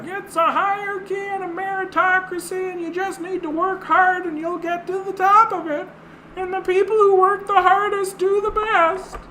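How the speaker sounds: very high squeaky mock voice; mocking meritocracy believer. Mocking people who say just work hard, in a silly high voice. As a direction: parody them in a falsetto.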